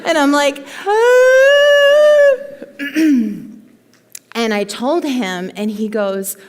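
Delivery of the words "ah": high, loud hammed-up wail; retelling calling her director, mock sob; mock sobbing wail. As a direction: imitate an incoherent sobbing cry. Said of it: "I was just sobbing".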